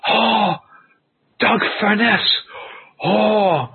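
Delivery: put-on moved veteran admiring an old opponent; Kobashi reminiscing. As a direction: draw out very strong with awe. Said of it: "and he goes".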